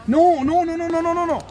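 loud, high-pitched string of no's; frantic, repeated objection; alarmed protest. Acted. Protesting as the other man acts.

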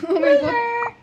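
slow, laughing exclamation. Real, not acted. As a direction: laugh it out, high and loud. Laughing at a kill.

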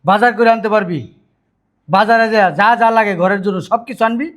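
loud, high, emphatic scolding; angry ultimatum. Acted. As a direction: lay down each condition forcefully. Elder brother lays down angry ultimatum.

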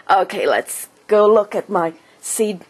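ordinary delivery

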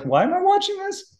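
put-on high, whining child voice; mock whiny kid. Father imitating his bored daughter, high whine. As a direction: whine it like a bored nine-year-old. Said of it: "She's like".

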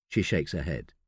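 ordinary delivery, nothing marked

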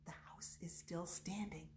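hushed storyteller whisper. Acted. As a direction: whisper it to the kids. Aside in hushed voice.

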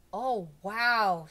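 loud, drawn-out wow; impressed wow. A narrator's reading. Jason marvelling that Bob never takes baths, impressed.

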